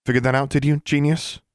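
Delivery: high, biting mockery; sarcastic retort. A narrator's reading. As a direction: scoff at him, pitch climbing.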